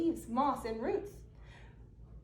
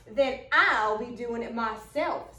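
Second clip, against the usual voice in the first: gruff gator; 5 semitones lower, 4 dB louder than usual. Acted. Gator answers the refusal.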